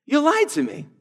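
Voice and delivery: high, indignant complaint; a wronged king protesting at being lied to; frustrated. Retold or imitated. King Abimelech to Abraham, high frustrated protest. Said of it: "Abimelech is rightly frustrated".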